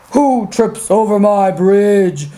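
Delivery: low, slow, menacing troll voice; roaring troll. Acted. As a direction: rumble a challenge as a big troll. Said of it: "roared the troll".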